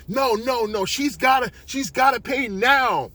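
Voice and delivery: high, loud refusal; angry demand. Acted. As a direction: shout down the offer and insist. Owner angrily refuses the offer.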